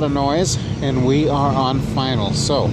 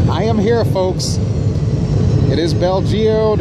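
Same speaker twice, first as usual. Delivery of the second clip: big excited arena-style welcome; hammed-up arrival announcement; 5 semitones higher than usual. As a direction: proclaim the arrival like a showman.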